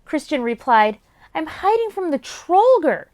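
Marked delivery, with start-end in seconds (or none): 1.3-3.1 put-on light raised boy's voice; young boy voice. Child character voice.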